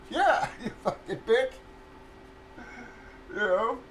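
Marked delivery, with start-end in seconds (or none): 0.1-1.5 higher, laughing through the insult; laughing jab. Retold or imitated. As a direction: spit it out mid-laugh. Retold insult to landlord.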